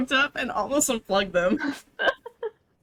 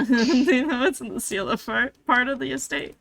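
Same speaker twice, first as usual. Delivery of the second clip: ordinary talk, nothing marked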